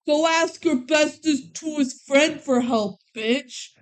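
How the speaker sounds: slow, mock-spiteful jab, word by word; catty retort. [name] to Kelly after hearing of another friend, mock-catty. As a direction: act out a catty brush-off, drawn out.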